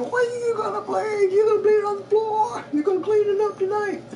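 high, strained mock yell; yelling drill instructor. Drill instructor yelling at a bleeding recruit, high mock yell. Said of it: "the T Is start yelling at you".

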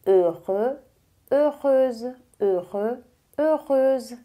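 "Heureux" with a rounded "ö" and no r sound at the end; "heureuse" gets a clear z ending; drill of heureux. Shown as a demonstration. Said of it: "vai ter que treinar essa palavra".

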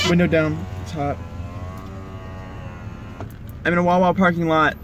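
normal talking voice

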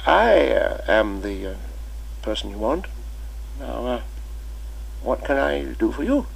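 put-on kindly old teacher welcoming a boy; old schoolmaster. Old Chips voiced in story.

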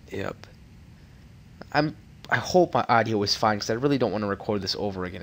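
ordinary talk

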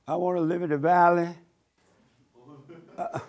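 mocking a whiny, begging prayer; a half-hammed plea, wanting everything. Preacher mimics congregants begging God, whiny. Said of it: "Stop whining to God".

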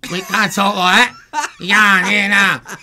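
high, loud honking Australian talk; duck-like Aussie voice. Retold or imitated. Singer mimics Australians sounding like ducks, loud high Australian accent. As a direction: ham up the quacky Australian accent. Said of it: "You guys sound like ducks".

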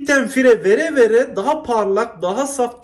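normal talking voice

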